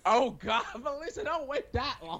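high, laughing plea. Real, not acted. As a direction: laugh through the words.

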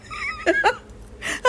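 high-pitched burst of laughter. Real, not acted. Host after her cartoon impression, high giggle. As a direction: crack up at your own silliness.

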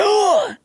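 sharp sudden intake of breath, high; imitated startled gasp. Describing waking in panic at night, sharp imitated gasp. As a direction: gasp as if jolted awake. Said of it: "I, like, wake up, like, jolted, and it's like".

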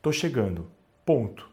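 low, soft, neutral read of a text; text message read-out. Shown as a demonstration. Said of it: "aquela mensagem de WhatsApp assim".

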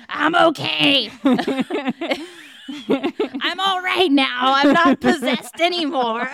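hammed-up demonic reassurance; devil voice. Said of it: "screams at them in a devil voice that she's okay".

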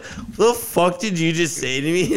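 high, incredulous mock outrage at the cop; indignant retort. Telling his friend how he snapped back at the cop, in high mock outrage. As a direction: throw it back at him, stunned and offended. Retelling reply to insulting cop.

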